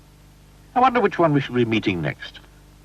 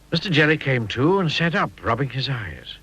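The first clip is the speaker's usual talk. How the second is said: ordinary delivery, nothing marked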